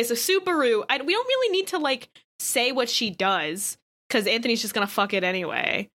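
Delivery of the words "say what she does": ordinary talk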